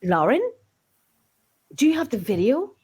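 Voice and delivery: usual speaking voice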